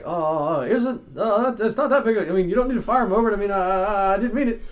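high, fast flustered babble; flustered backtracking. Retold or imitated. Angry customer suddenly backtracking, high and fast. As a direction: stammer a hasty retreat. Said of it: "started to backtrack".

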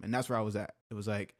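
normal talking voice

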